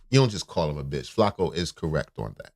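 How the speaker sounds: usual speaking voice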